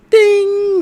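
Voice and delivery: high, loud vocal ding; ringing quiz-show ding; imitated bell ding. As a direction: sing out a game-show answer bell. Imitating the game-show answer bell.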